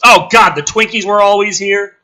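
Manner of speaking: loud, high hammed-up disbelief; mock shock. Host mocking the Twinkies plot twist, loud mock shock. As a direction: gasp it out in fake horror.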